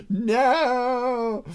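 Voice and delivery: hammed-up gasp of horror; mock horrified gasp. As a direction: gasp then a high, loud mock cry of no.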